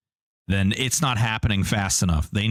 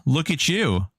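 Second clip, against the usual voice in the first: usual speaking voice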